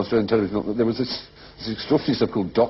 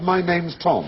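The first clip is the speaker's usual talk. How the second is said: mock like-speak; 8 semitones higher, much slower than usual. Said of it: "He was like".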